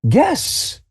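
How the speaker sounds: put-on high delighted old man; old grandfather. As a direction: marvel at the crowd like a fussy grandpa. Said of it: "He's like".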